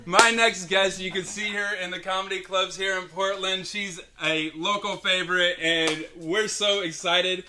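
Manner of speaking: loud, stretched emcee announcement; announcing the next guest. Real, not acted. Host introducing a local comic to the crowd, loud emcee call.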